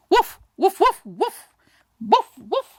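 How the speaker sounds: high yappy woofs, fading as the barks go on; playful dog barking. Acted. Dogs barking a message from dog to dog, high playful woofs. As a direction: bark it out like a little dog sending a message.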